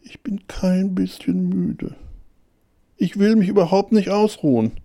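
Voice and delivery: high-pitched, breathy start firming up to clear insistence; emphatic denial. A narrator's reading. Tired hare denying tiredness, high insistence. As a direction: start hushed and high, then state it audibly. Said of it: "sagte er laut und vernehmlich".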